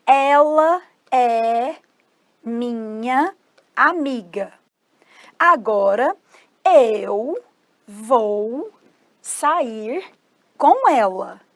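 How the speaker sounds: word by word with long pauses; slow demonstration. Shown as a demonstration. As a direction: dictate it slowly, stretching each word. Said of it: "vou falar pra vocês como que fala".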